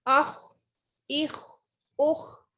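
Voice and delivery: अख with a thick, heavy throat kh. Shown as a demonstration. Teacher to students, heavy throaty kh. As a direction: make the ख in अख, इख, उख full and heavy. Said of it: "यहाँ पे हम इसको मोटा करेंगे".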